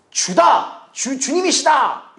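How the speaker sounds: high, drawn-out mock call; John's cry. John calling to Peter on seeing the Lord, acted high cry. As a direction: call out high, stretching the words. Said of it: "요한이 외치는 거를".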